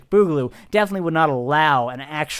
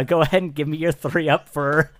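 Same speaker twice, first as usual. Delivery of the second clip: ordinary talk, nothing marked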